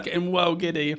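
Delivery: normal talking voice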